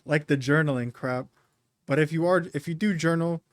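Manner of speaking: ordinary talk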